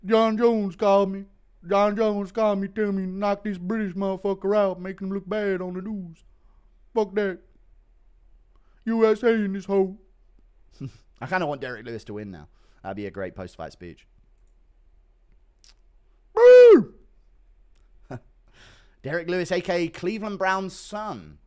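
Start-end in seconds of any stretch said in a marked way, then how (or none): 0.1-10.0 higher, louder brash fighter voice. Acted. 16.3-16.9 loud high whooping yell; victory whoop. Acted.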